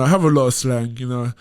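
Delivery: ordinary talk, nothing marked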